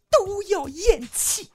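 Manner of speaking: slow, drawn-out 咽气; riddle setup. Acted. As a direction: stretch the word for suspense. Telling riddle slowly.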